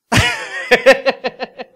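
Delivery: big high burst of laughter; cackling laugh; loud laugh. Real, not acted. Laughs at own joke.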